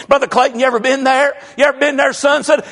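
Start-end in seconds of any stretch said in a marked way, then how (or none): none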